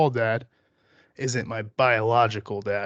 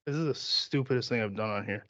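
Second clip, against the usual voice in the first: normal talking voice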